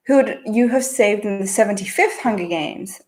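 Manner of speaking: usual speaking voice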